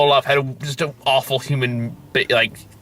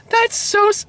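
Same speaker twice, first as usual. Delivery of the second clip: very high cartoon snowman squeak. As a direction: pitch it way up like a little cartoon figure. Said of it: "So [name]'s like".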